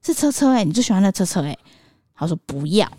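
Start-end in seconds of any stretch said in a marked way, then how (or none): 0.0-1.6 put-on higher, sing-song coaxing of a toddler; mother sweetly tempting her son with his toy car, coaxing. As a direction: coax the child with a lilting higher voice.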